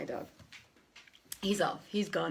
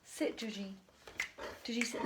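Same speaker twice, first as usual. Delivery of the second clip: usual speaking voice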